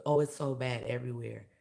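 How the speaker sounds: ordinary talk, nothing marked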